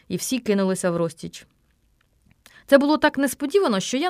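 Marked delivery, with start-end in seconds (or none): none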